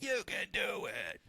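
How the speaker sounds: high, soft, spent mock yell; exhausted cheering. Rooting the guys on till exhausted, worn-out cheer. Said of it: "he's exhausted".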